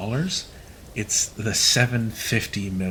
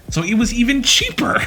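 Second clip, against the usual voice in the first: excited punchline; 12 semitones higher, 5 dB louder than usual. Retold or imitated. Punchline of retold explanation.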